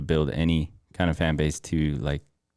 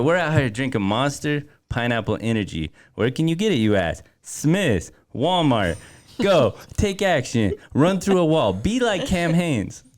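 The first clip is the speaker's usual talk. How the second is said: energy drink promo; 6 semitones higher than usual. Pretend. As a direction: go all in on the hype promo. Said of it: "I'm gonna do it one more time".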